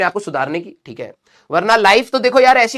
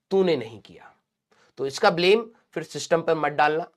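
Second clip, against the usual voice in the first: whisper; 2 semitones lower, 5 dB quieter than usual. Real, not acted. Teacher to a dropped-year student, don't blame the system, whispered. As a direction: drop to a stern whisper. Teacher's quiet blunt closing reproach.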